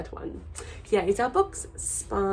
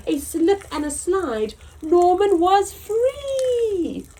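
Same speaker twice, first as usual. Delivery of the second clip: lively storybook reading, big swoops in pitch; reading to children; 7 semitones higher, 6 dB louder than usual. A narrator's reading. Teacher reads to children, bright storybook voice. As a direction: read it out to little listeners. Reads picture book aloud.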